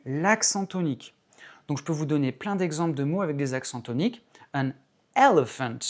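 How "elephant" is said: "elephant" with EL punched loud; English "elephant", strong EL, reduced ending; stress on first syllable. Shown as a demonstration. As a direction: hit EL in "elephant", let the rest drop. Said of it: "accent sur la première".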